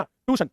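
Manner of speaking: ट्यूशन as "too-shun", without the y; common misspelling voiced. Shown as a demonstration. Teacher quoting a child's spelling mistake, ट्यूशन mispronounced "tooshun". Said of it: "बच्चा लिखता है".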